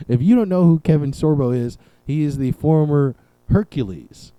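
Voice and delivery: usual speaking voice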